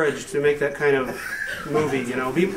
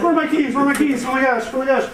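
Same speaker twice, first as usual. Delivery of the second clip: higher-pitched frantic searching; hammed-up fretting, pitched up; mock panic; 9 semitones higher than usual. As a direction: act out a flustered hunt for lost keys. Acts out hunting for lost keys.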